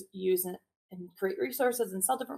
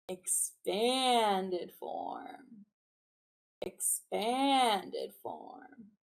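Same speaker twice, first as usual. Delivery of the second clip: very slow stretched call; much slower than usual. Shown as a demonstration. Teacher and class stretching the words out very slowly. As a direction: draw Expanded form out like it's expanding. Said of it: "I'll say it, you respond".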